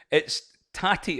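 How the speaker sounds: "tatty" drawn out, hard t, short a; tatty said slowly. Shown as a demonstration. Presenter teaching the player to say "tatty" slowly. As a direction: spell out "tatty" slowly for the English guest. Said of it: "It's actually tatty scone".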